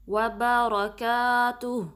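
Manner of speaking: slow, drawn-out Quranic recitation. Shown as a demonstration. Teacher reciting the phrase for students to copy. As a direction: chant it slowly with a heavy lam on اللَّهِ. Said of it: "وا one count".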